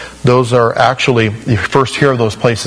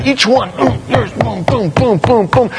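gleeful ant squashing; 9 semitones higher than usual. Acted. Mocking squashing ants one by one.

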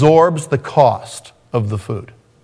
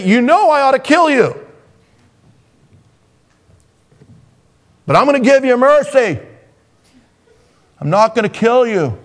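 put-on a high, strained parent scolding the kid; exasperated parent; 10 semitones higher than usual. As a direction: play the fed-up parent who spares the child. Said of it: "and you say".

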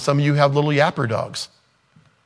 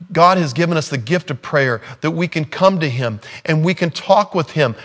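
ordinary talk, nothing marked

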